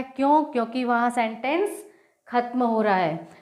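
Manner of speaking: normal talking voice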